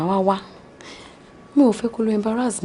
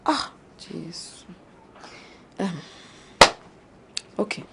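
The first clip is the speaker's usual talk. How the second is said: whispered groan; 3 semitones lower, 9 dB quieter, slower than usual. Acted. Despairs at his singing.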